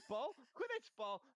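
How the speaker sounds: excited dog voice. Pretend. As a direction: bark it like an eager dog.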